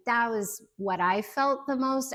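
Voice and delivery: usual speaking voice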